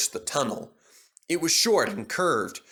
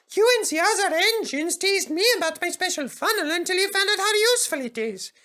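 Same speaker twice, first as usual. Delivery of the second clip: Peter Sam. A narrator's reading.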